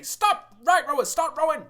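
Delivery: very high, loud urgent yell; shouted command. Acted. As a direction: shout across the boat to correct rowers. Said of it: "Dwagar say".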